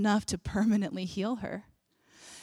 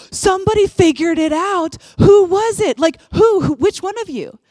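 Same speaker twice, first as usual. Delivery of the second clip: much higher and louder, eager and delighted; excited shout; 9 semitones higher, 12 dB louder than usual. Retold or imitated. Jesus calling to the crowd, overjoyed and loud. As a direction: call out thrilled, hunting for who did it. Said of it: "He was excited".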